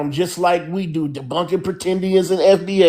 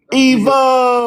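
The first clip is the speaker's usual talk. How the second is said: loud, high, drawn-out name call; hailing a newcomer; 7 semitones higher, 4 dB louder than usual. Real, not acted. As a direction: greet an arriving friend with gusto. Greeting newcomer joining the panel.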